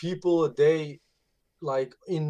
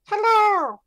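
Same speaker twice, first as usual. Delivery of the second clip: very high, loud, squeaky little hamster; high-pitched hamster squeak, pushed loud; 10 dB louder, slower than usual. Shown as a demonstration. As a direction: squeal it out like a tiny cartoon hamster. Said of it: "I can do a hamster voice".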